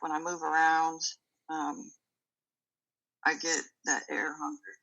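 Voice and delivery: normal talking voice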